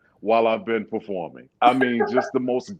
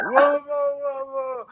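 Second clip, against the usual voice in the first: imitated heckler; 14 semitones higher, slower than usual. Woman in the audience heard by the singer, imitated heckler. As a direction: mimic the woman's whoa-whoa. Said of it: "The woman".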